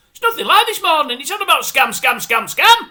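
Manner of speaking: loud, high-pitched incredulous complaint; exasperated rant. Real, not acted. Viewer complaining the show is all about scams, loudly exasperated. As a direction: hammer the repeated word in exasperation.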